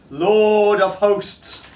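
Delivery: plain reading voice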